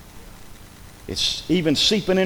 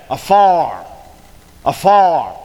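"Afar" said louder with a falling tune; stressed afar; 3 semitones lower, 3 dB louder, much slower than usual. Shown as a demonstration. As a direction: land hard on "Afar". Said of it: "what's that next word say?".

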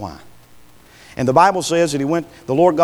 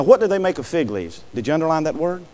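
ordinary talk

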